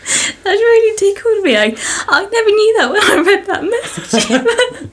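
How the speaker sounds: high, giggly voice still laughing. Real, not acted. Host still giggling over the listener's message, laughing. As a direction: talk while still cracking up. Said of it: "Literally crying right now".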